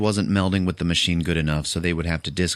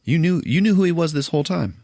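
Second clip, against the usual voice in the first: much higher, louder angry accusation; accusing; 9 semitones higher, 3 dB louder than usual. Retold or imitated. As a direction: confront her with betrayal. Mira accuses the doctor.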